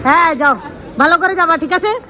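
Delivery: see her off lovingly; tender farewell. Real, not acted. Farewell call to aunt.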